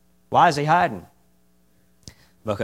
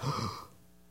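fearful gasp; 15 dB quieter than usual. Acted. Adam's first fear.

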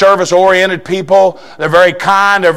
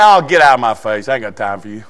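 dismissive brush-off; 9 semitones lower, faster than usual. Retold or imitated. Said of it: "Somebody comes in and says".

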